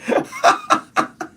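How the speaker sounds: breathy high giggle; high laugh. Real, not acted. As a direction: laugh at your own joke. Laughs at own joke.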